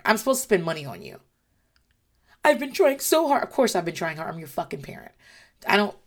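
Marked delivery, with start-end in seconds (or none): 2.4-3.4 mocking whine. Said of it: "mocking the parent's complaint".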